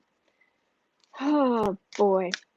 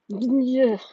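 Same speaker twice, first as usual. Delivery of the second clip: loud, exasperated "ugh"; disgusted groan; 3 semitones higher, 5 dB louder than usual. Real, not acted. Host fed up with the man she discusses, exasperated groan. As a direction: let out a groan of frustration. Disgusted break while talking politics.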